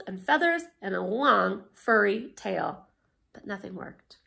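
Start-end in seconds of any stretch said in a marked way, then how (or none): none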